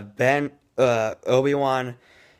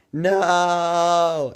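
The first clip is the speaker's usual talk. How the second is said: long, high, loud mock wail; shocked cry; 7 semitones higher, 5 dB louder than usual. Reviewer acting out Luke learning of his father, shocked wail. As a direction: draw out a hammed-up cry of shock. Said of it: "Luke is shocked also".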